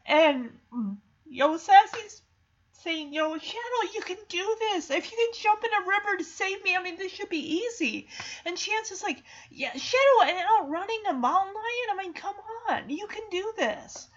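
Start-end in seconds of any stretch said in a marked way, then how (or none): 3.1-8.1 high-pitched, coaxing pep talk; quick, high, pleading encouragement; urging encouragement. Acted. Sassy urging Shadow to climb. 9.5-14.1 high, eager pep talk; pleading, high-pitched coaxing; urging encouragement. Acted. Chance cheering Shadow on.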